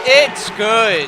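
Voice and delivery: sudden high, loud burst as the shot drops; excited call. Real, not acted. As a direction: shout it as the ball goes in. Made three-pointer, live call.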